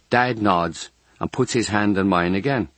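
plain reading voice

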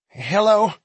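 high, loud nervous call; unconfident call. Acted. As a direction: call out uncertainly. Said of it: "called without confidence".

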